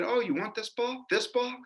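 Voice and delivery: high-pitched mock taunt; put-on high voice, goading questions; taunting woman. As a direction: imitate a spiteful woman dangling a prize. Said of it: "finally she said".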